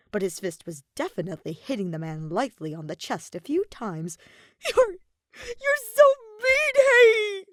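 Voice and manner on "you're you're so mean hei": high, loud, slow crying reproach; sniffling cry. A narrator's reading. Kaveh crying into Hei's neck, calling him mean. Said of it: "The blonde sniffled".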